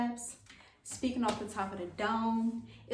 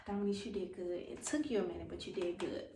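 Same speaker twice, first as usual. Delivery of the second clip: self-praise; 3 semitones lower, 6 dB quieter, faster than usual. Real, not acted. Praising herself softly.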